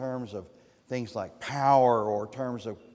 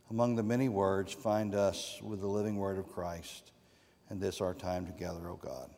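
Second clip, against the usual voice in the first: prayer; 5 semitones lower, 4 dB quieter than usual. Acted. Closing prayer before the sermon.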